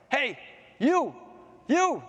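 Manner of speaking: high, sudden call to a passerby; mysterious calling voice. Acted. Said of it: "he heard this voice".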